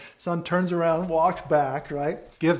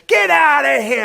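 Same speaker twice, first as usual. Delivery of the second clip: high, explosive dad holler; imitation of a father's loud send-off; shouted dismissal; 12 semitones higher, 12 dB louder than usual. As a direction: bellow the brush-off as the dad. Dad shouts his answer.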